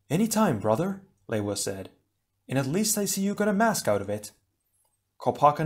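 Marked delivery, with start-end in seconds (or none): 0.1-4.3 put-on higher, breezy young voice; Leiwa's voice. Leiwa apologising to his brother after the rough landing, light and higher.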